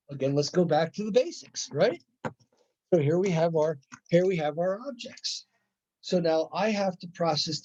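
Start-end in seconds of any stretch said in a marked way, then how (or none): none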